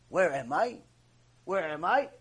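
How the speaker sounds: low, softened mock bewilderment; dazed, low-voiced questioning; groggy waking. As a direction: play someone coming round after passing out. Husband waking after passing out drunk.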